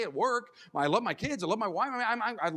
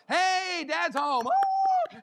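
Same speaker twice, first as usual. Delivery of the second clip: loud, much higher joyful call, ending in a whoop; excited greeting; 11 semitones higher, 5 dB louder, much slower than usual. Retold or imitated. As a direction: burst in the door happy. Cheerful homecoming retold.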